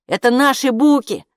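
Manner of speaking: high, loud call; shouting back. A narrator's reading. Oskar calls back to Kaapo from the door, high shout. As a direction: shout it back across the flat. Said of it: "крикнул в ответ Оскар".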